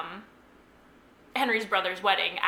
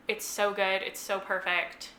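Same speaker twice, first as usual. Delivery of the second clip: usual speaking voice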